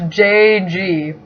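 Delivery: usual speaking voice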